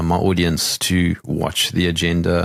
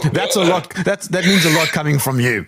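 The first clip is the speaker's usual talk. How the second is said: imitating English accent; 8 semitones higher than usual. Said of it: "That was my English accent".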